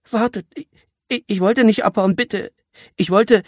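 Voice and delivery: high, stammering plea; scared captive pleading for mercy; frightened pleading. A narrator's reading. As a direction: beg desperately, stumbling over the words. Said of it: "Das Flehen des Jungen".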